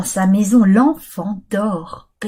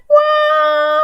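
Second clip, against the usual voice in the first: wail; 3 dB louder than usual. Acted. Child wakes crying.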